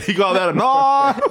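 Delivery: exaggerated Australian "knife" through the top jaw; top-jaw Australian accent. Host mimicking the guest's method, hammed-up Australian accent. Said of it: "through your top jaw".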